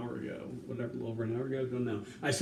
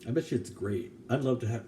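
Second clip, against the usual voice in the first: ordinary talk, nothing marked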